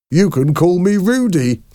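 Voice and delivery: high mocking imitation ending in laughter; imitating Mister Hoven. A narrator's reading. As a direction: mimic him in a high voice, then crack up. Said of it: "started to imitate him".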